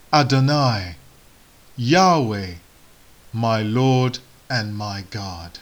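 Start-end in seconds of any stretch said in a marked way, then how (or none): none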